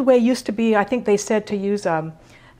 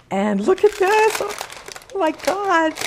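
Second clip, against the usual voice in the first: excited; 9 semitones higher than usual. Real, not acted. Bread revealed after baking.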